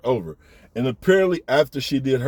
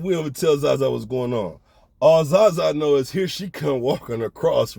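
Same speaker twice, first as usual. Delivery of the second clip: ordinary talk, nothing marked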